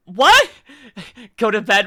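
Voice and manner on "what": sudden high, loud what; jolted by what she just heard; startled what. Real, not acted. Reacting to a clip, a sudden high loud what.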